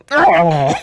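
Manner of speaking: a loud, startled Oh; hammed-up shocked cry; mock surprised gasp. Apocalypse's reaction, acted.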